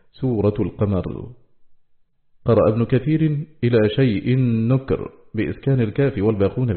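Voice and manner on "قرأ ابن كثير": normal talking voice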